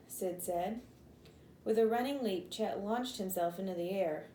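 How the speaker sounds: ordinary delivery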